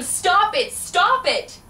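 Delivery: high loud repeated cry to stop; yelling. Retold or imitated. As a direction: shout it again and again. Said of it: "And I kept yelling".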